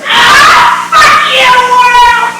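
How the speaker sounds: triumphant yelling at everyone; defiant shouting. Acted. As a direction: fling curses at the whole world.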